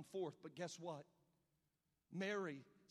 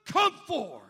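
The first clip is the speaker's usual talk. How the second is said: huge, high, slow commanding shout; preacher bellowing Jesus' command at the tomb; 24 dB louder, much slower than usual. Acted. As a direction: call the dead man out of the tomb. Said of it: "and then he shouts".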